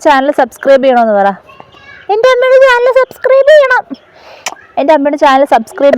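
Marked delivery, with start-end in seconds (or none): 2.1-3.8 much higher excited plea. Real, not acted. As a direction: pipe it up eagerly. Child recites requested line.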